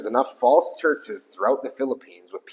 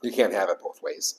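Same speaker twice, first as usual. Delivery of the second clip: earnest preacher driving the point home; sermon preaching; 3 semitones lower, faster than usual. Acted. Pastor preaching to a small congregation, earnest.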